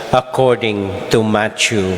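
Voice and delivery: ordinary talk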